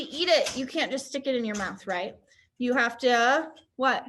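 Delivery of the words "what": usual speaking voice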